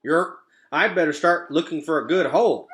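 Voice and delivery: loud, high croaky frog; a frog croaking about finding a hole; second croaking frog. Acted. Second frog answers, loud and croaky. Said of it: "croaked the second frog".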